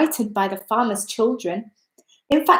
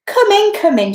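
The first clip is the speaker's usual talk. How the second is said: put-on welcoming old woman; 8 semitones higher, 4 dB louder than usual. A narrator's reading.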